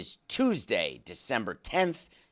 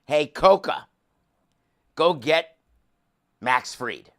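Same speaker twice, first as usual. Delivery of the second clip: mock order; 3 semitones higher, 6 dB louder than usual. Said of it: "They actually could have said".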